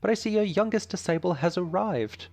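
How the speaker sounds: put-on British accent. British dojo master greets the professor's student, British accent. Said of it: "gave the dojo leader a British accent".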